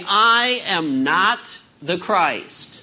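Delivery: slow, every word weighted; preacher spells out I AM NOT to the congregation, slow and emphatic; emphatic denial. Shown as a demonstration. Lean on each word, slow and deliberate. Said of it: "Stated emphatically".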